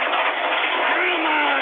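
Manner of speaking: high, slow, drawn-out roar; enraged yell. Real, not acted. Host demanding the mic in a fit, enraged yell. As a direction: blow up and stretch the words out.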